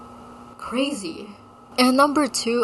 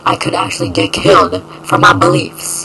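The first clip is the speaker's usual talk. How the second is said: mock dramatic alarm; 2 semitones lower, 11 dB louder than usual. Dramatic exaggerated claim.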